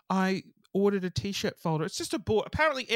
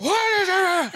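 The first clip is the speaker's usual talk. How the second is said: loud, high outburst; retold tantrum; 11 semitones higher, 8 dB louder, slower than usual. Daughter snaps at mum over boiling potatoes, tantrum yell. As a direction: blow up like a child in a tantrum. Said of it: "I threw this huge tantrum".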